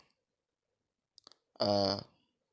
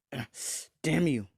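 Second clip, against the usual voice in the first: louder, high-pitched acted outcry; mock pained curse; 5 semitones higher, 4 dB louder than usual. Wounded Freed cursing Issei, mock angry cry. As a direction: curse the attacker in a raised, hammed-up cry.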